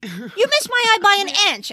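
high, mock-indignant squawk; retold outburst. Shoplifter to woman with staple gun, mock outrage. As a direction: squawk it in theatrical protest. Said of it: "and he goes".

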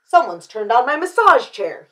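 loud, indignant storybook dad; big-voiced outrage; bellowing father. A narrator's reading. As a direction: bellow the accusation. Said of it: "he bellowed".

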